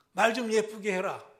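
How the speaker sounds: loud commanding rebuke; loud scolding. Retold or imitated. Someone scolding another, loud rebuke. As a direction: tell them off.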